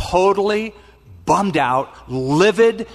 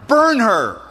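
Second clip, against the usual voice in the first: mock verdict; 7 semitones higher, 5 dB louder than usual. Judah's verdict retold.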